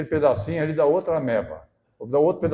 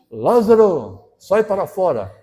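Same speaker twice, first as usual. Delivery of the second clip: Lázaro drawn out slow, then a loud order; slow loud command; 5 semitones higher, 8 dB louder, slower than usual. Retold or imitated. Jesus at the tomb ordering Lázaro out, slow and loud. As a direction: summon the dead man out.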